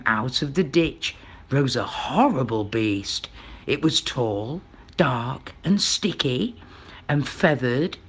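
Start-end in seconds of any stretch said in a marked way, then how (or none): none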